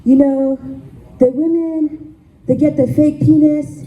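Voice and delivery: put-on Haitian accent. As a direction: imitate a fervent pastor with a Haitian accent. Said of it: "I have to do the accent again".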